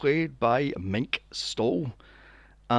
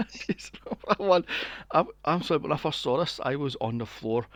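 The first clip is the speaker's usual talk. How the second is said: ordinary delivery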